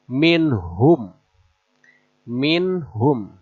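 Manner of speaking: minhum with the n and h both distinct; clear nun. Shown as a demonstration. Teacher shows clear nun before the ha. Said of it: "Jelas dibaca nunnya".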